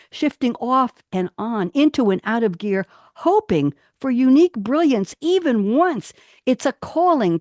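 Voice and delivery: dramatic spoken-word delivery; poetry reading. Acted. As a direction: read each word with weight, like a performance. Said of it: "I'll try to emphasize the word".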